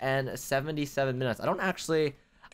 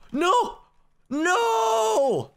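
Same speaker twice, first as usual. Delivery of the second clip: very high, long-held scream of despair; anguished scream; 4 dB louder than usual. Real, not acted. Player who just fell so hard, anguished scream. Falls from the parkour.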